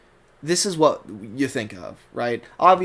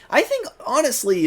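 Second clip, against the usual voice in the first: lightly voiced older cartoon character; a hint of a character voice; Sunshine; 11 semitones higher than usual. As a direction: imitate a relaxed, slightly older character. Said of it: "Sunshine talking something like this".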